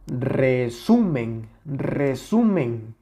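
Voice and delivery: resumen very slowly, SU long and prominent; stress on SU. Shown as a demonstration. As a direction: exaggerate the second syllable of resumen. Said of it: "aquí se escucha la mayor intensidad".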